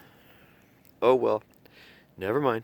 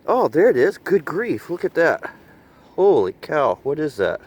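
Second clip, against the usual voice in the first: excited; 6 semitones higher, 7 dB louder than usual. Real, not acted. Excited at finding object.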